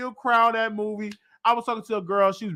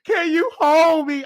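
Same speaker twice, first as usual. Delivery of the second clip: whiny plea; 9 semitones higher, 3 dB louder than usual. Retold or imitated. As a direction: beg to be held, half-sobbing. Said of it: "I was like".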